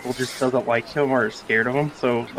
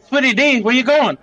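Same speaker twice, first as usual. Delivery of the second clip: raised, higher-pitched call; calling after someone; 10 semitones higher, 4 dB louder than usual. Acted. Character calling after a companion walking off, raised call. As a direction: call out to a departing friend.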